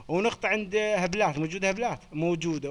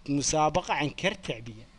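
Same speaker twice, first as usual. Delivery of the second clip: normal talking voice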